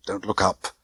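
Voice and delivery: usual speaking voice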